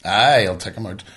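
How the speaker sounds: ordinary delivery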